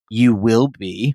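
low, slow threat; menacing computer. Acted. The laptop answering Romney, low and ominous. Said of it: "the computer types".

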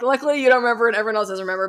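fast, loud, giddy repeat; half-laughing relief; giddy rush. Real, not acted. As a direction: rattle it off with relief. Repeating the joke quickly.

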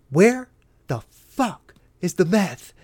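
each chunk slow, with hard pauses between; staged slow demand. Acted. Officer staring down handcuffed private, slow staged demand. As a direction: draw out the interrogation word by word. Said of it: "so I can do this in the camera".

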